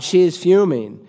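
ordinary talk, nothing marked

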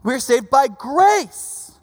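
slow, weighty, each word landed; grave, deliberate proclamation; slow emphatic. Retold or imitated. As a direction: lay it down slowly for the class. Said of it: "He's like".

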